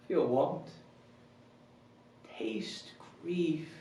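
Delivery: humbled king, hushed and halting; soft words spaced by long, heavy pauses; Richard the Second. Acted. King asking how they can call him that, subdued and broken up by pauses. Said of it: "performing Richard the Second's monologue".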